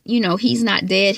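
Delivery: ordinary talk, nothing marked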